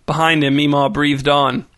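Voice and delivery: usual speaking voice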